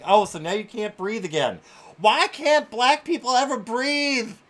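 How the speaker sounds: sarcastic, rising to a loud high outburst; mock the claim with loud scorn; mocking outrage. Real, not acted. Streamer mocking a news clip, loud sarcastic outrage.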